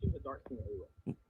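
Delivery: muffled, distant bad connection; underwater. Real, not acted. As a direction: voice drowned as if underwater. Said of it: "you sound like you're underwater".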